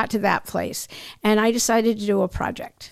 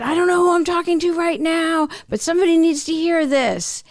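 higher and brighter, the motivational instructor calling out; spin teacher; 7 semitones higher than usual. Retold or imitated. Guest retelling her spin teacher's words, higher motivational call-out. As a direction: channel the inspiring spin coach in the dark class. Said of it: "and she said".

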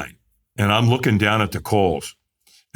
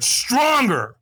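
a firm raised call of stronger, higher pitched; firm command; 10 semitones higher than usual. Retold or imitated. Trainer urging the firewalker on before the coals, firm raised call. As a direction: push him to give more, firmly. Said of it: "And he went".

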